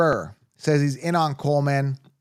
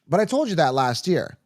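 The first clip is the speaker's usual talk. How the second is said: ordinary delivery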